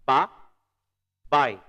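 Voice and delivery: "Ba, bye" split by a long pause; "Ba" and "bye" separately, second one loud; syllable by syllable. Shown as a demonstration. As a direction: break it into "ba" ... "bye" for learners. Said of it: "a gente fala bye bye".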